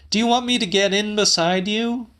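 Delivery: high and fast, a touch exasperated; Celia, irritated. A narrator's reading. Woman fed up with the old man's commands, fast and high.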